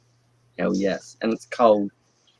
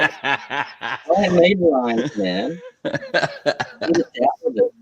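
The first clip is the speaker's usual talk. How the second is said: slow laughing delivery; 6 semitones higher, much slower than usual. Real, not acted. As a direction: talk through laughter, slowly. Laughing while speaking.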